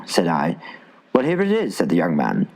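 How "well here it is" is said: bright offer. A narrator's reading. Young man presents the drink.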